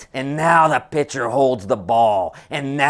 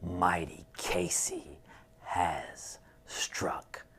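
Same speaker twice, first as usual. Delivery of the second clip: unvoiced, mournful whisper; grieving whisper of defeat; sorrowful whisper; 8 semitones lower, 14 dB quieter than usual. A narrator's reading. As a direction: let the heartbreak hush the room. Tragic final line of the poem.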